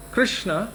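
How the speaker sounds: Krishna, high, with clear kri; Krishna as the guru said it; own Krishna. Shown as a demonstration. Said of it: "I say it like that".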